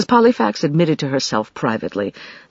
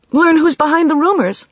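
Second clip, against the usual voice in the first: high, louder impatient retort; 14 semitones higher, 6 dB louder than usual. A narrator's reading. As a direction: snap it back impatiently. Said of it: "Katie said impatiently".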